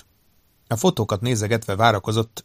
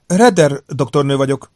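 lightly voiced female doctor, higher and louder; woman's voice; 4 semitones higher, 7 dB louder than usual. A narrator's reading. Doctor answering Davenport's phone call, lightly voiced woman's voice. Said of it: "megszólalt egy női hang".

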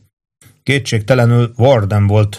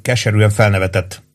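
usual speaking voice

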